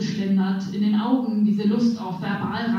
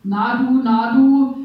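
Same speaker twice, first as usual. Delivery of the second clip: put-on loud, slurred drunk call, getting louder; drunken slur; 3 semitones higher, 7 dB louder, slower than usual. Drunk man in the night bus calls to her, slurring loudly. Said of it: "lallte mir ein Daunenjackenträger".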